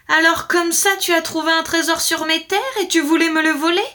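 high, loud accusing question; stern shout. A narrator's reading. Lord accuses man of stealing treasure, stern shout. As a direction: thunder it like an outraged lord. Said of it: "Il lui cria sur un ton sévère".

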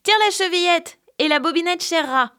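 calling loudly from bed; old grandmother calls the visitor in; called out. A narrator's reading. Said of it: "lui cria". (la grand-mère crie depuis son lit)

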